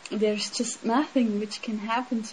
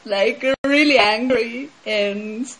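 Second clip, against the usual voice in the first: ordinary talk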